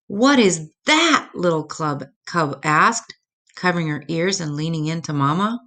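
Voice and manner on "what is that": put-on startled child. Ice crashes, cub frightened.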